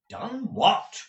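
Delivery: high, insistent question; impatient demand. Acted. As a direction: demand an answer, pitch jumping up. Ralph presses Gride for an answer.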